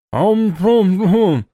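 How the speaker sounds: slow muffled mumbling through a gag; gagged. A narrator's reading. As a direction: moan it behind a gag. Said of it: "замычал через кляп тот".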